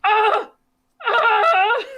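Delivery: loud, high, pained yells; hammed-up pained yells; reenacted yells of pain. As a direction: cry out as the mint burns. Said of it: "it was like".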